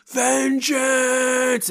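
high, drawn-out cry of vengeance; hammed-up vengeful scream. Hero screaming in the rain after his sons die, high hammed-up howl. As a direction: throw back the head and howl it to the rain. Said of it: "screaming in the rain".

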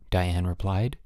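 usual speaking voice

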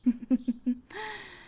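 a high, falling laugh; high mocking laugh. Mocking the guest with a high laugh.

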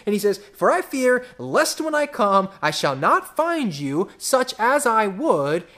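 high, loud pulpit fervor; rising heat of a fired-up preacher; full-force preaching. Acted. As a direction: preach it hard to the whole room.

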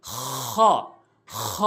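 খ with long rasping friction in the throat; throaty kha. Shown as a demonstration. Teacher introducing the Arabic letter, rasped খ. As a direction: scrape খ out from the end of the throat. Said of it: "হলকের শেষ থেকে".

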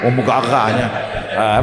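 usual speaking voice

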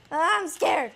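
loud, high frightened whine; scared cry. Real, not acted. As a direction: cry it out in fear. Scared to go back in.

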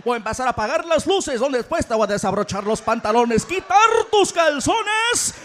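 loud hyped radio ad voice; Spanish radio ad. Acted. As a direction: pitch the night like a radio ad. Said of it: "what's he gonna tell her".

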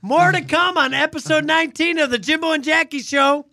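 upbeat host sign-off; radio host. Acted. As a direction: announce it like a show host. Host announcing next segment.

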